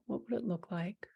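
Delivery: soft unvoiced whisper. Shown as a demonstration. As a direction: whisper the question gently. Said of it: "whispered repeat".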